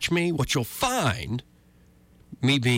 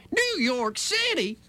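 high, drawn-out, scandalized repeat; mock outrage; slower than usual. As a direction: stretch it out way up high in mock disbelief. Mock-outraged repeat of the city name.

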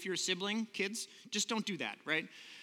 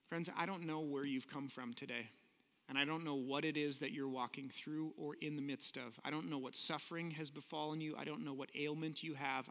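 gentle pastoral; 4 semitones lower than usual. Acted. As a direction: comfort the hurting, gently. Pastor speaks to suffering listeners.

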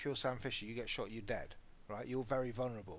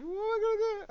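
put-on much higher, louder near-tears child wail; mock tearful wail; 9 dB louder, much faster than usual. Dad imitating his daughter near tears, high wail. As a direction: wail it like a kid about to cry. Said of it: "she was almost in tears".

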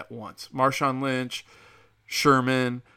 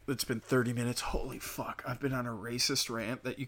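muttered aside; 8 dB quieter than usual. Real, not acted. Realizing rant ran long.

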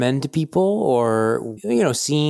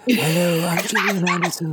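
teasing impression of an actress's trademark voice; Gillian Anderson impression; 6 semitones higher than usual. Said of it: "Does she talk like this?".

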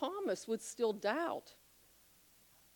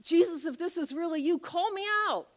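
eager Peter; 7 semitones higher than usual. Retold or imitated. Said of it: "Peter's like".

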